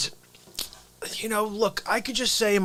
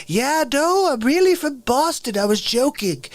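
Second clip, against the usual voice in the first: much higher, airy put-on wife confessing; mocking wife voice; 9 semitones higher than usual. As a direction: squeak it out like a caught fibber. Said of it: "pretend when you come out and you say".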